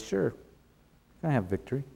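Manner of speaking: mock agreement, dropped soft and dry; sarcastic. Micaiah answering King Ahab about the battle, sarcastically. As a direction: say yes while meaning no. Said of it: "he clearly said it very sarcastically".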